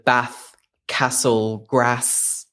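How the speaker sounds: spaced-out "Bath Castle Grass", all short A; short-A word list. Shown as a demonstration. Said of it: "get back to that".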